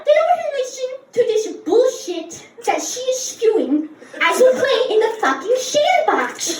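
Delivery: high-pitched lisping rant, "sandbox" as "shandbox"; outraged little kid with a slushy lisp; childhood lisp. Acted. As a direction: complain with every s in "listen" and "sandbox" slurred to sh. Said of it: "'cause I had a lisp".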